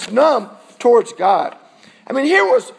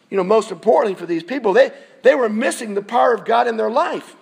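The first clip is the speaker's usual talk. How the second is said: usual speaking voice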